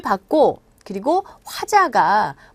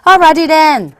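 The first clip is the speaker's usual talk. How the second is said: loud, high Alrighty then; exuberant call; 4 semitones higher, 11 dB louder, much slower than usual. Shown as a demonstration. Teacher moving on to the next section, exuberant call. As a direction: belt it out as a playful catchphrase.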